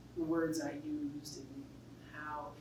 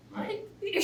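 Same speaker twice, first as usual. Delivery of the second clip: surprised; 14 semitones higher than usual. Retold or imitated. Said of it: "we were like".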